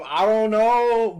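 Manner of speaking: drawn-out, loud mock "I don't know"; mock shrug. Quoting her reply in a drawn-out, loud dodge. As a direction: drag out "I don't know" like dodging the question. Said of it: "but she said".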